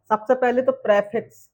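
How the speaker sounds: ordinary talk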